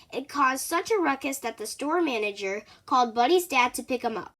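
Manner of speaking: ordinary delivery, nothing marked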